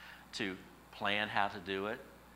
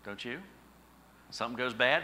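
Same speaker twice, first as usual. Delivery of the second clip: ordinary talk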